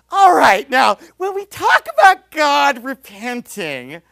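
mock a preacher addressing grown men like little kids; talking to toddlers. Mocking a preacher who teaches grown men Hebrew, exaggerated toddler-teacher voice. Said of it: "This is how he talks to grown men".

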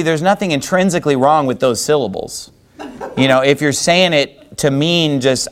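normal talking voice

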